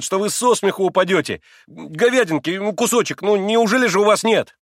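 high, loud, excited explaining; eager boasting. A narrator's reading. Boy showing off the trained dog, eager and excited. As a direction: tell it all proudly and urgently.

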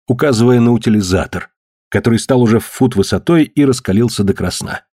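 plain reading voice

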